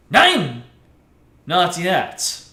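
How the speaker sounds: a touch of a German prosecutor cutting in; mock German accent, hammed up. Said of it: "a deep voice, a German accent".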